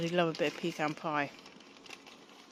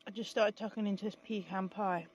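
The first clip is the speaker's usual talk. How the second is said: ordinary talk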